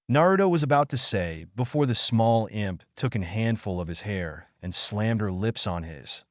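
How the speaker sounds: ordinary delivery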